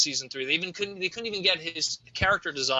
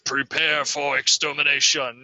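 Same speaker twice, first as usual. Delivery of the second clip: menacing robot voice; 4 semitones lower, slower than usual. Retold or imitated. Quoting the iconic Devastator.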